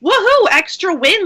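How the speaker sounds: high, celebratory whoop; playful cheer. Acted. Cheering a bonus bit of time.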